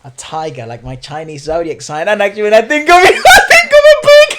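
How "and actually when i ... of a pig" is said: laughing. Real, not acted. Teasing reveal of friend's zodiac.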